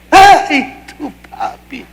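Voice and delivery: high cry falling away to a voiceless whimper; mock wailing. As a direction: imitate a grieving aunt's wail, dying off. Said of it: "alguien me abraza gritando por la espalda". (lamento agudo imitado que se apaga)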